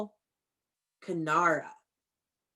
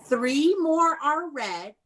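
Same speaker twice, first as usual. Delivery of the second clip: slow read; 5 semitones higher, slower than usual. Shown as a demonstration. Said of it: "teacher reading slowly to class".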